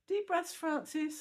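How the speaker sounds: high, slow, gently coaxing nurse; lovely soothing voice. Retold or imitated. Nurse coaxing patient in recovery, high soothing and slow. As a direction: soothe a drowsy patient awake, slowly. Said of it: "this lovely voice saying".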